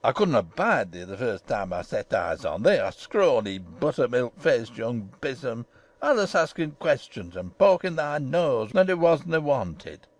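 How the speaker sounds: put-on swooping, indignant old Yorkshire gardener; haranguing. A narrator's reading. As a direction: rant in thick dialect, pitch rising and plunging. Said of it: "he harangued".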